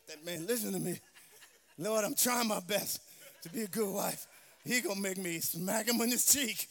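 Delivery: complaining wife. Acted. Wife voiced complaining about husband.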